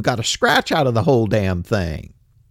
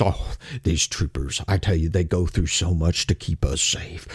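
mock reverent sympathy; 10 semitones lower, 6 dB quieter than usual. Host mimicking commenters praising troopers, mock reverent. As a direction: imitate a fawning fan, hushed and much lower. Mocking gushing badge-licking commenters.

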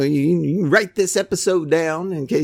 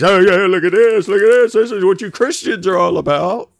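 mocking taunt; 4 semitones higher, 6 dB louder than usual.

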